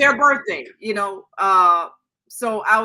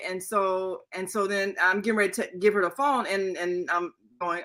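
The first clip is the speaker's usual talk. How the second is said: normal talking voice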